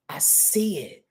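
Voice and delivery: soft whisper of "I see it". Pretend. Repeating the human's vision to viewers, in a whisper. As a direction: breathe it like a secret.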